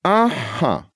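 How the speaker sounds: drawn out, pleased with itself; slow satisfied aha. A narrator's reading. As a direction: savour it, smug and slow. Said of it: "медленно и удовлетворённо протянул голос".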